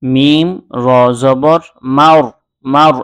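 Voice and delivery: মাউর with a thick, full র; heavy r. Shown as a demonstration. Teacher demonstrates the heavy র in মাউর. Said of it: "এখানে র মোটা হবে".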